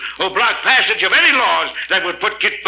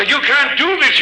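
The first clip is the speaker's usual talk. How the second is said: angry protest; 8 semitones higher than usual. Acted. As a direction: burst out against the ruling. Berg protests dismissal.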